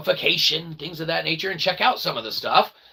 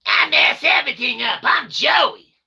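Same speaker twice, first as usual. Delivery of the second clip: mock Joey voice; 9 semitones higher than usual. Streamer mocking his friend Joey in a goofy high voice. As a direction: play Joey as a bumbling goof, yelling. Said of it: "He doesn't talk like that".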